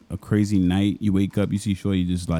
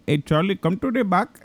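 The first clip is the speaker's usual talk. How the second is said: higher-pitched caricature Indian boss; mock Indian accent; 8 semitones higher than usual. Boss summoning his clerk to the back, mock Indian accent. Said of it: "I was mimicking my boss at seven eleven".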